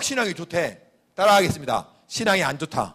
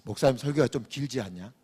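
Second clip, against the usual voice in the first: quieter, lower insinuating aside; sly insinuation; 5 semitones lower, 8 dB quieter than usual. Retold or imitated. Infiltrator insinuating to members, quiet. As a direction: plant doubt slyly. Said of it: "약간 뉘앙스를 들으면서".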